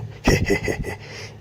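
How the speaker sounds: low, breathy villain's chuckle; husky evil he-he-he; wicked snicker to oneself; tiger's gloating laugh. Acted. Delighted tiger gloats secretly.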